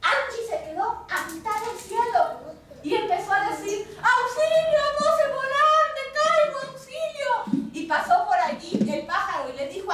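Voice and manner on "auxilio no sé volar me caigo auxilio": much higher and louder, a sing-song panicked call; frightened cry for help. Acted. Falling star calling out for help, high and frightened. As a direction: wail it like a little star falling from the sky.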